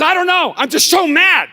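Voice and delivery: high, loud exasperated cry; imitated angry son. Son stuck at midnight telling dad he's mad, loud exasperated cry. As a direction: blurt it out in frustration.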